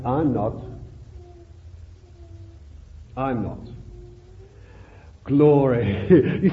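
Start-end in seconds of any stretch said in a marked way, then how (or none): 0.1-3.6 voice of the Lord. Retold or imitated. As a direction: quietly reassure as the Lord. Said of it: "The Lord says".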